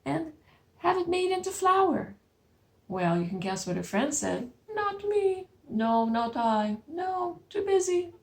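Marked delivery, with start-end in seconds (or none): 0.0-2.1 high hen voice; little red hen. Acted. Storyteller voices the hen asking for help. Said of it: "little red hen said". 4.7-8.1 high lazy animal voices; lazy friends. Acted. Storyteller voices the animals refusing.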